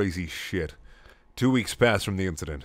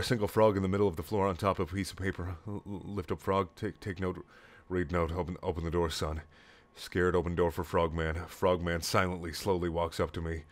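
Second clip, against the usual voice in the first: hushed suspense, much softer; quiet; 2 semitones lower, 6 dB quieter than usual. Acted. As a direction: drop to a tense hush. Tense creepy part.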